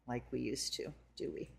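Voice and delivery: breathy whisper to herself; whispered aside. Real, not acted. Reader settling in before the cards, whispered. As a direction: drop to a whisper before starting.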